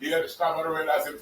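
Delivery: ordinary talk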